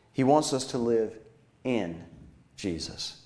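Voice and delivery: "in" set apart by pauses, dropping low; stress on in. Real, not acted. Preacher correcting living for Jesus, stress on "in". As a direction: pause before and after "in", saying it low and soft. Contrasts in with for.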